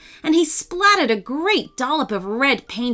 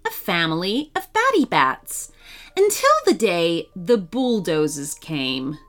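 usual speaking voice

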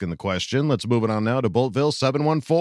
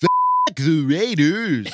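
high, loud, drawn-out singer impression; 6 semitones higher, 4 dB louder, slower than usual. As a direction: stretch it out in a hammed-up, raised folk-singer voice. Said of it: "the one that tickled you was my [name]".

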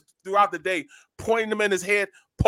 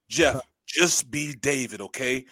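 calming reassurance; 7 semitones lower, 5 dB quieter, slower than usual. Retold or imitated.

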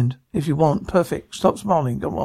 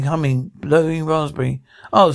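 ordinary talk

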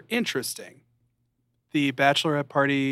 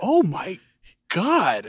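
mock shock; 6 semitones higher, 5 dB louder, slower than usual. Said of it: "and I was like".

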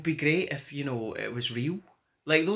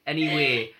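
ordinary delivery

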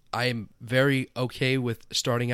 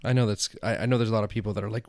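normal talking voice

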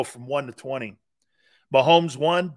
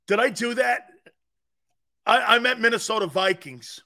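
higher, hammed-up innocent "oops"; mock flustered; 6 semitones higher, 4 dB louder than usual. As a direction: play dumb about the slip, pitched up. Mock slip, Urkel-style catchphrase.

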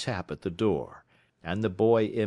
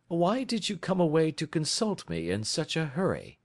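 a lightly voiced Holmes, higher and assured; 9 semitones higher than usual. A narrator's reading. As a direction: read Holmes as the cool, knowing detective.

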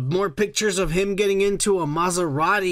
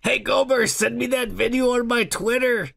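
ordinary talk

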